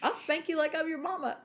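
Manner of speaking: high-pitched, laughing mock threat; retold comic threat. Woman retelling her threat to a young kid, comic scolding. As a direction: threaten playfully, on the edge of laughter.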